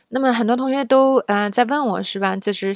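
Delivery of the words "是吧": normal talking voice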